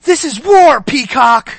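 loud, high movie-quote outburst; full-force comic battle cry; yelling. Acted. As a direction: yell it in her face. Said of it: "he yells in her face".

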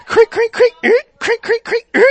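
playful squeaky creaking noise; creaking hook noise. Pretend. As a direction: mimic a rusty hook reeling someone in. Pulling someone back with hook.

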